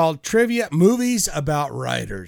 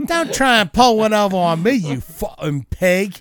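hammed-up British tough talk; mock British accent, starting high; 4 semitones higher, 3 dB louder than usual. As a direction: scold like an irate Brit. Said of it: "That's British".

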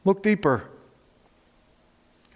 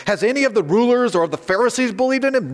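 higher, quicker challenging question; retort; 6 semitones higher, faster than usual. Acted. Pharisees challenging the guards about the rulers, high quick retort. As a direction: retort as an indignant Pharisee, lightly acted. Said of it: "the Pharisees retorted".

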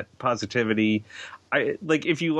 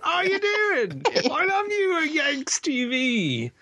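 much higher, hammed-up fake British accent, laughing through it; a deliberately bad British accent, pitched way up and giggling; terrible British accent; slower than usual. Co-host teasing the host as a fake British guest, in a high, laughing, terrible British accent. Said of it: "terrible accent".

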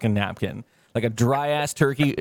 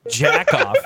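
louder, laughing jab at the friend; laughing insult; 6 dB louder than usual. Retold or imitated. Teasing friend who couldn't Google it, laughing jab. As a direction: tease him through a laugh.

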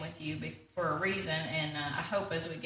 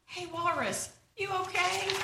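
higher pig voice, gently checking; concerned Pig; 10 semitones higher than usual. Acted. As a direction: ask after him in a light high Pig voice. Pig checking on fallen Walrus.

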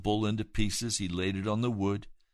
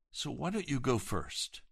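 usual speaking voice

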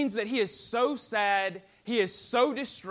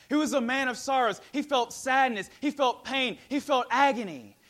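louder, higher preaching climax; fervent preaching; 4 semitones higher, 5 dB louder than usual. Acted. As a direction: drive each line home from the pulpit. Preaching at full force.

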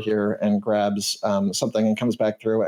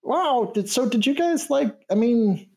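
excited canary; 12 semitones higher than usual. Acted. Canary NPC keeps gushing.

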